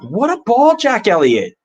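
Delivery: high, loud burst of delight at a great pass; excited cheer. Real, not acted. Fan cheering a sixty-yard pass, high excited cry. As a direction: cheer the ball like it just landed perfectly.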